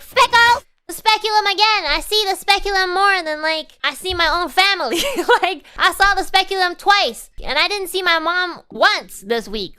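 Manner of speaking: loud, high comic rant; hammed-up, high-pitched indignation; mock outrage. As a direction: explode at hearing the dreaded tool named. Comic outrage at another speculum.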